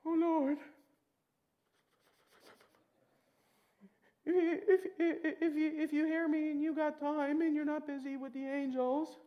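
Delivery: mock a nervous pray-er tripping over words; hesitant mock prayer full of ifs and ors; wishy-washy prayer. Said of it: "a little bit more wishy-washy".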